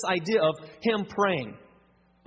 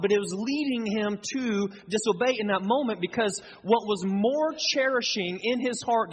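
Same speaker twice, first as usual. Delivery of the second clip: usual speaking voice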